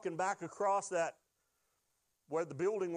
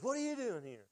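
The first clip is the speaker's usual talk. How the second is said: mildly startled, higher-pitched wife; startled greeting; 4 semitones higher than usual. Retold or imitated. Wife to husband home early from work, startled. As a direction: retell it as a quick, high question of surprise. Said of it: "Well, not like, Brr".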